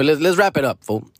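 ordinary delivery

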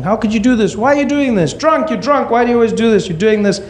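high-pitched, relentless scolding; mom shouting. Retold or imitated. Mother shouting at husband mid-fight, high mock tirade. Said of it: "my mom was shouting".

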